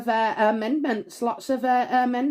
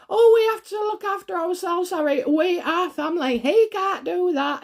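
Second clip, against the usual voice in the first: higher, whiny pleading wife; lightly voiced wife urging her husband; a hint of Meghan pleading; 7 semitones higher than usual. Voicing Meghan pleading with Harry.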